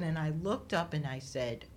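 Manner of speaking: ordinary talk, nothing marked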